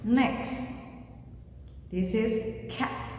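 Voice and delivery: normal talking voice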